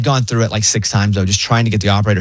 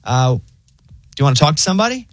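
chill robot operator; 7 semitones higher than usual. Acted. Pharmacy AI announces a delay, weirdly chill. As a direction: break bad news like a laid-back assistant. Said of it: "it's so weirdly chill".

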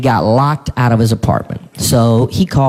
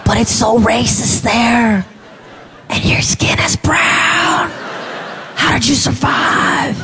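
high, slow gasping disbelief; mock shocked outsider; 9 semitones higher, much slower than usual. Comedian mocking people shocked by South Carolina, high and slow. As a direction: play the horrified stranger, way up high. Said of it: "they're always like".

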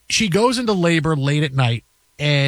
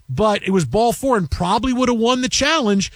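usual speaking voice

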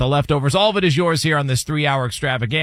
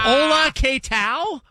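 mock Spanish accent; 11 semitones higher, slower than usual. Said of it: "put on a sombrero".

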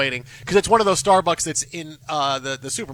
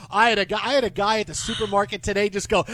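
normal talking voice

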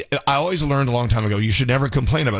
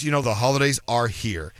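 usual speaking voice

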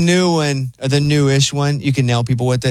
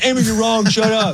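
higher mock-bossy scolding; imitating Eddie; 8 semitones higher than usual. Host mimics Eddie yelling at Amy, higher and mocking. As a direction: tease him by aping his scolding. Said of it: "the whole time going".

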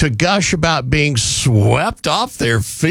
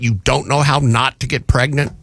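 ordinary talk, nothing marked

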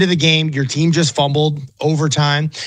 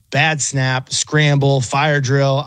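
ordinary delivery

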